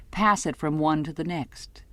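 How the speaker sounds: ordinary delivery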